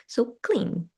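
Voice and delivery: normal talking voice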